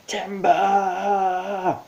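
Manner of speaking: high, loud yell of timber; playful shouted timber; shouting timber. A narrator's reading. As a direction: bellow it like a lumberjack.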